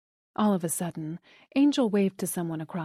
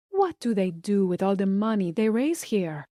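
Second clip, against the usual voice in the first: put-on light Spanish accent, airy. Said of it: "with a thick Spanish accent".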